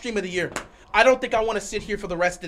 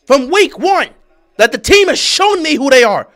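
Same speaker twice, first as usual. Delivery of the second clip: loud, raised-pitch fan rant; ranting yell; 6 semitones higher, 13 dB louder than usual. Real, not acted. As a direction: yell your take in exasperation. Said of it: "I'm not screaming at you".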